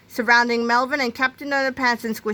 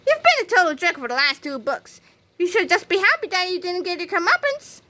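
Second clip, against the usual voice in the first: higher-pitched telling-off; scolding; 9 semitones higher than usual. A narrator's reading. George tells Melvin off for two books of misbehaviour, scolding. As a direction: lecture him, pitch climbing toward the end.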